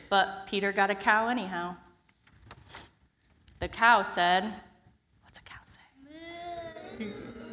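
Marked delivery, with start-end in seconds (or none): 5.2-7.5 whisper. Real, not acted. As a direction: lean in and whisper. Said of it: "whispered aside to kids".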